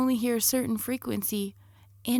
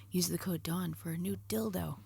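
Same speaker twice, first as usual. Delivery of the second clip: whisper; 3 semitones lower, 10 dB quieter than usual. Acted. Ad read whispered.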